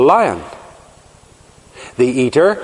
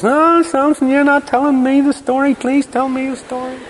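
high, whiny pleading to Samson; nagging wife; 12 semitones higher than usual. Retold or imitated. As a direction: beg and nag, much higher and wheedling. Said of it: "a woman who nagged".

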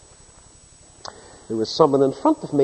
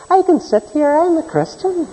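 higher, mocking whine of a smug believer; 11 semitones higher than usual. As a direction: parody the self-righteous churchgoer, pitched up. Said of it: "doesn't mean to say".

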